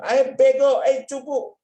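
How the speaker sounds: high and loud "dumb, loser"; brash playground bully; shouted insult. Acted. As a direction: taunt a classmate hard. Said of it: "Umpamanya bilang".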